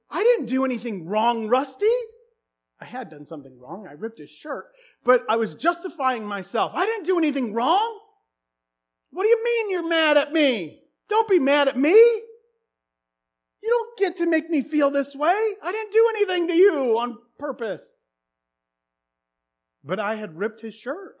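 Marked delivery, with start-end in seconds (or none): 0.1-1.8 higher, louder whiny protest; mock defensive whine. [name] insisting to Rusty he did nothing wrong, whiny. Re-enacting his own justification. 6.7-17.9 high, loud indignant whine; defensive kid rant. Retold or imitated. [name] justifying himself to angry Rusty, indignant whine.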